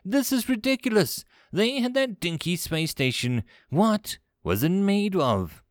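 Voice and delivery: high-pitched, frustrated demand; exasperated commander. Acted. Commander out of patience with primitives, exasperated.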